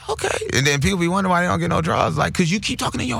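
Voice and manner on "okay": high voice. Shown as a demonstration. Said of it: "high voice".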